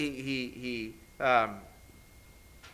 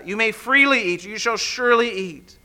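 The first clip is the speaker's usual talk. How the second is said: "freely" and "surely" punched, raised and louder; emphatic; 6 semitones higher, 6 dB louder than usual. Acted. Preacher explains the original language, emphatic on "freely" and "surely". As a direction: lean hard on "freely" and "surely". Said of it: "it's emphatic".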